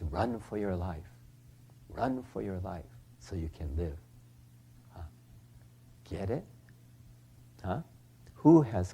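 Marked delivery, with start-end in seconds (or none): 0.0-4.0 quiet. Retold or imitated. Genshin's warning.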